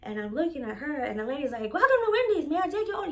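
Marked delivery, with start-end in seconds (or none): 1.7-3.1 loud, high mock drive-thru attitude; hammed-up snippy fast-food greeting; catching attitude. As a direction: imitate an impatient worker repeating the greeting, much higher and louder. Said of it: "she catching attitude".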